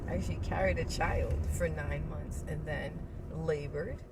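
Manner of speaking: usual speaking voice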